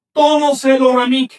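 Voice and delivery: "Tono se lo namiki" said loud and clear, stress on tono; Nahuatl demo. Shown as a demonstration. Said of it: "Se compone del verbo namiki".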